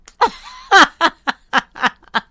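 loud high nervous giggle; nervous laugh. Real, not acted. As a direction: laugh nervously before a confession. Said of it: "like, the nervous laugh".

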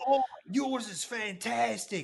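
put-on loud, high, slow Australian accent; drawn-out mad Australian; upset Australian. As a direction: exclaim it like a worked-up Aussie. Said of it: "I can only be an upset Australian".

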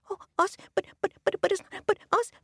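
high, squeaky frightened frogs; little frog voice. Acted. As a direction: stammer like a tiny frog.

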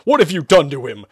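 building to a loud cry; horrified shout. A narrator's reading. Exorcist appalled at the uncovered head, shouting. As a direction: cry out in horror. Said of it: "Sherman shouted".

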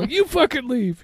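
high, slow mock demand; mock angry retort. Husband reenacts the fight with his wife, slow high mock retort. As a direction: draw it out like a petty jab. Quarrel continues.

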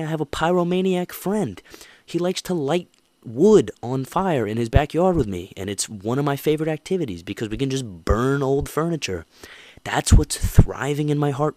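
fired up. Real, not acted. Host on burning things with a friend, fired up. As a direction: belt it out with relish.

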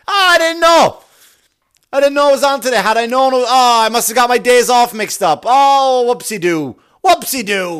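high, loud hammed-up self-reproach; mock yelling. Crazy psycho person yelling at their chicken, mock yelling. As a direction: yell at yourself like a crazy person. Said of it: "Just yelling at it".

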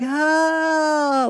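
a high, sudden cry of terror; mock terrified cry. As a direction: imitate his cry of terror as he falls. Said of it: "he was just terrified".

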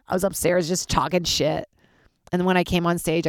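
usual speaking voice

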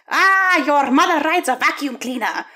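put-on high, loud jeering taunt in a German-Austrian accent; mock German accent taunt. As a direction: fling the insult like a cackling kid with a thick Austrian accent. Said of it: "Austrian accent".

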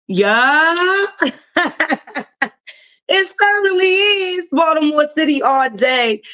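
high, drawn-out Baltimore accent, proudly owned. Real, not acted. Host answering a question about the accent, proud high Baltimore twang. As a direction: play up the Baltimore accent with pride. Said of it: "Is that a B More accent?".

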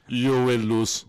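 slow, loud, heavy Russian threat; Drago. Pretend. Playing Drago, threatening the opponent slowly and loudly. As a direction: spell out a cold Russian boxer's threat. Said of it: "I'll be Drago".

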